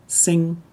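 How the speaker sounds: sing, ending in ng as in English; Northern dialect ending. Shown as a demonstration. Teacher giving the Northern word for cute, ng ending. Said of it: "pronounced like N G".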